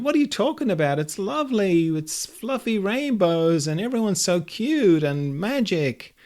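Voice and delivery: high-pitched, incredulous gushing; fluffy-game player protesting. Acted. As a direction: insist sweetly on a fluffy fantasy world. Blissful My Little Pony player argues.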